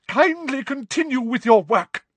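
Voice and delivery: wounded dignity; Trelawney, stiff. A narrator's reading. Trelawney to the class, high and curt.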